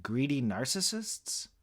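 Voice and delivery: unsure, voice lifting into a question; tentative guess. A narrator's reading. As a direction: venture a timid guess. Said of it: "I guessed".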